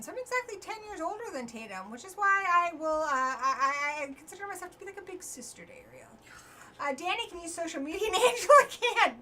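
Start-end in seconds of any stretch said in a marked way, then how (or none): none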